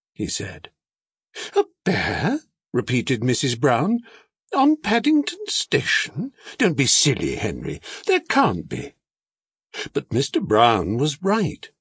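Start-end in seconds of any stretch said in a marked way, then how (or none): 1.6-2.4 incredulous. A narrator's reading. Wife doubts husband's sighting. 4.5-8.9 high-pitched, disbelieving scoff at a husband; incredulous. A narrator's reading. Wife disbelieves husband's claim.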